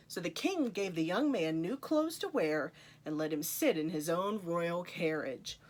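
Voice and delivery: ordinary delivery, nothing marked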